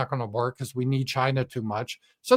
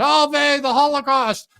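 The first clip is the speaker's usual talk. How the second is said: high, loud parody of shocked outrage; mock outrage; 12 semitones higher, 7 dB louder than usual. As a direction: gasp it like a scandalized anchor. Mocking media outrage.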